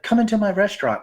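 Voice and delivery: a hint of a high-voiced old woman; little old lady. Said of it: "a little old lady in a corner was like".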